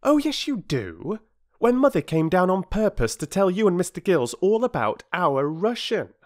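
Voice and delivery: high-pitched, emphatic schoolchild; eager, contradicting young voice; insistent child. A narrator's reading. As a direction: insist as a child setting someone straight.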